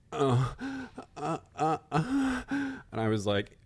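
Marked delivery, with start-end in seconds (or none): 0.1-2.4 short moans climbing higher, imitating a partner; mock moaning. Mimicking a past hookup to co-host, mock moaning. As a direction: imitate a hookup's breathy "uh, uh" noises. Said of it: "and he was like".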